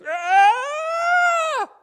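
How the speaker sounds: long, high, very loud wail; gut-wrenching wail. Shown as a demonstration. Preacher showing how mourners cry without hope, gut-wrenching wail. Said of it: "if you ever heard a person wail".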